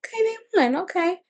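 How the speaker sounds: high, bemused acceptance of the news; a high, half-joking "okay, they won"; mock surprised okay. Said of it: "and I was like".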